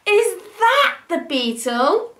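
high, slow triumphant question; proud elephant showing off; triumphant trumpeting. Acted. Elephant holds up his find, triumphant. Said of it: "he trumpeted triumphantly".

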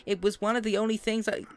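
high, loud character voice reading a letter; Casey's voice. Acted. Streamer reading a letter aloud to viewers, high character voice. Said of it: "I can't remember exactly my voices".

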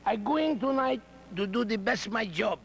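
lightly put-on broken English with a Spanish accent; mock Mil Mascaras. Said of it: "half-wits that speak broken English".

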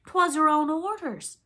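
high, loud, slow protest; faltering. A narrator's reading. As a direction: protest nervously to the captain. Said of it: "the boatswain faltered".

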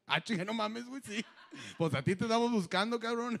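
laughing through the words, quickening; can't believe it, cracking up; laughing disbelief. Retold or imitated. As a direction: searcher laughing at the missing man. Searcher realizes he found him.

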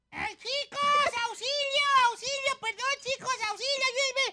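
high, frantic cries for help; panicked shouting. Acted. Cat calling friends for help, panicked high shouting. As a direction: cry out to friends in hammed-up panic. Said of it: "¿Por qué tanto grito?".

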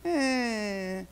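imitated drawn-out shrug of a sound; mock indifference; unimpressed. Southern kids hearing about shoe fly pie, unimpressed.

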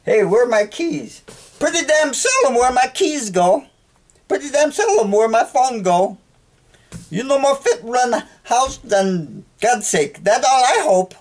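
high, put-on Chinese accent in broken English; mock Chinese accent. Man who lost his bags yells at station counter in mock Chinese accent. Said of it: "Chinese dialect".

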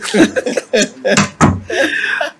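laughing at the guest's answer; airy high laugh. Real, not acted. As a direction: let out a long breathy laugh.

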